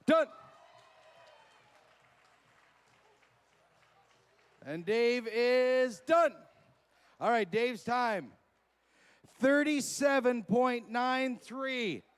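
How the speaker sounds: loud, high live hype calls; hype announcer. Acted. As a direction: shout the action and the times.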